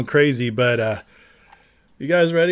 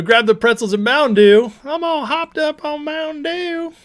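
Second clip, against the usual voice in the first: a lightly put-on, much higher jittery voice; hyped party voice; 12 semitones higher, 3 dB louder than usual. Streamer hams up being wired on Mountain Dew, much higher. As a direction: squeak it out like you're wired on soda.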